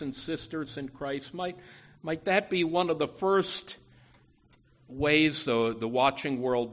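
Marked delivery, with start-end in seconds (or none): none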